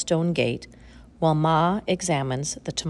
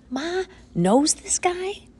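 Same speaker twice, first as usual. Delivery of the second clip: surprised; 13 semitones higher, slower than usual. A narrator's reading. Boy's surprised inner thought.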